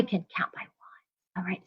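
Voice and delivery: quick whispered aside. Real, not acted. Teacher whispers to her class before counting. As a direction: breathe it out in a whisper.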